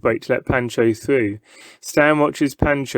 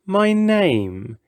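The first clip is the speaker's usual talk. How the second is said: grand showman; 8 semitones higher than usual. A narrator's reading. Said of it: "he says into the hush".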